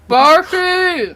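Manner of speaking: loud call across the room; shouting a name. Acted. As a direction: yell the name to fetch someone. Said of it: "He's calling Darcy!".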